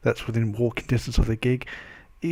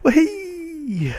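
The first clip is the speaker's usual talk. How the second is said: squealed high and loud greeting; excited squeal; 4 dB louder than usual. Real, not acted. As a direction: burst out with a high happy hey. Cheery greeting after vlog intro.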